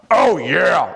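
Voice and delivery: loud wrestler-style cry; excited shout. Shown as a demonstration. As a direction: yell it with hype. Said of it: "Randy Savage with excitement and enthusiasm".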